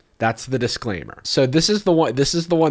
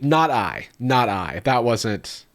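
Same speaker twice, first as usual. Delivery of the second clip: usual speaking voice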